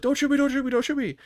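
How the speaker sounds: very high mock-panicked squeal; panicked plea. Teammate begging his friend not to fire, panicked. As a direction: imitate frantic, repeated begging.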